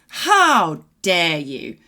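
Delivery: drawn-out affronted demand; mock outrage. Old lady scolds the cheating old man, mock outraged and slow. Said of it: "she's absolutely outraged".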